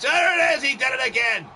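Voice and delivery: high, loud sudden outburst; excited shout. Real, not acted. Commentator sees the wrestler snap again, excited shout. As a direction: burst out yelling at the action.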